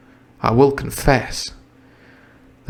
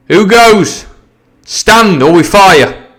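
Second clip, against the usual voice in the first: loud, higher hail across the stockade; calling out; 8 semitones higher, 6 dB louder, slower than usual. Acted. Captain hailing the buccaneers, a loud challenge. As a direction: bark a challenge to the mutineers.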